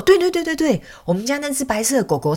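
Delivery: high, excited rapid-fire confirmation; eager agreement. Retold or imitated. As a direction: imitate an owner recognising it instantly. Owner eagerly confirms the dog's habit.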